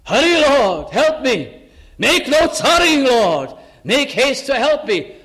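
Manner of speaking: urgent plea. Retold or imitated. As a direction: cry out to God, pressing and urgent. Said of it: "it climbs up like in the psalm".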